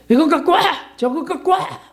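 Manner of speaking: mock a demanding person barking errands; bossy demand. Preacher mocking a believer ordering Jesus around, bossy. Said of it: "이렇게 할 수 있습니까?".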